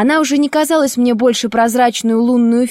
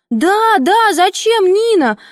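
put-on high, girlish reproach; chiming-in schoolgirls; 9 semitones higher, slower than usual. A narrator's reading. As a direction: join the complaint in a high voice. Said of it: "подхватили девочки".

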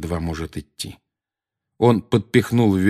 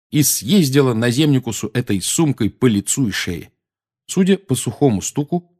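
ordinary delivery, nothing marked

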